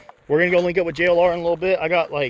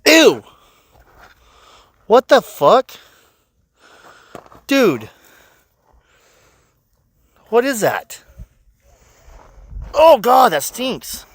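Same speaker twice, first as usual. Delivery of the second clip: high, loud disgusted shrieks; recoiling from a horrible stench; smelling something awful; 10 semitones higher, 7 dB louder, much slower than usual. Real, not acted. Finds something that reeks.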